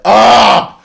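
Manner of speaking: short, high, loud scream; mock scream of despair; anguished scream. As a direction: cry out loudly in fan agony. Reacting to the credits homage.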